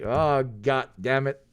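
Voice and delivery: ordinary talk, nothing marked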